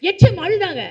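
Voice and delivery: loud, high proclamation that the head mistress cried; emphatic exclamation. Real, not acted. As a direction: announce it loudly and with drama. (உரத்த உயர்ந்த குரலில் வியப்புடன்)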